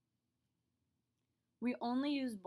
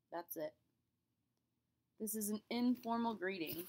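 ordinary talk

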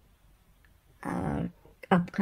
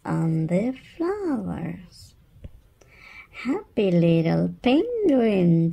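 ordinary delivery, nothing marked

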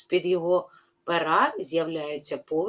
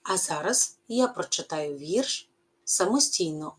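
normal talking voice

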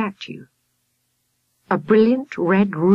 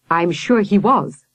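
grim reply; 3 semitones higher, 3 dB louder, slower than usual. A narrator's reading. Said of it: "said the professor grimly".

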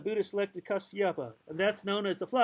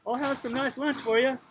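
called out a little higher; lightly raised, friendly call; 4 semitones higher, 3 dB louder than usual. A narrator's reading. Christine calls to the men in the yard, calling out. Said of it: "to watch us and called".

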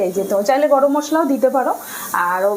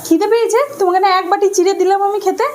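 higher, incredulous; but I just gave you chira; surprised mother; 7 semitones higher than usual. Real, not acted. Mother answering hungry child.

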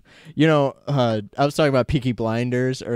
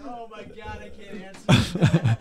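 real helpless laughter; 12 semitones higher than usual. Real, not acted. Co-host dodging a shockingly dark question, helplessly laughing.

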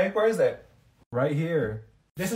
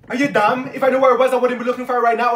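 louder, fast, annoyed retort; exasperated snap; 3 semitones higher, 6 dB louder, faster than usual. Acted. Student snapping at Olivia while hunting her ring. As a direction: bite back at her in frustration. Frustrated hunt for lost ring.

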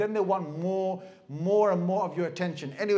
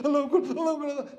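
rushed takbir; 7 semitones higher, 4 dB louder, much faster than usual. Retold or imitated. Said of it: "and you go".

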